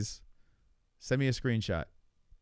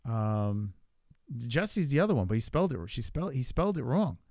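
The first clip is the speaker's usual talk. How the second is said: ordinary talk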